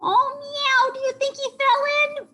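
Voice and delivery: very high, loud, fast cat voice; frantic cartoon cat in falsetto; alarmed cat. A narrator's reading. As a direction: meow in a rushed panic. Cat character alarmed in story.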